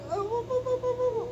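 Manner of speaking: very high wa wa whimper; imitated puppy whimper. Owner mimics the dog's whining, high whimper.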